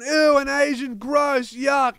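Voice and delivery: disgusted group yell. Retold or imitated. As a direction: imitate a group crying out in disgust. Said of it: "yelling in a poor guy's face".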